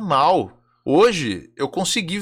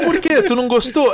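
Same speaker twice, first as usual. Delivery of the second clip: mock innocent; 7 semitones higher than usual. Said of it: "Aí eu falei".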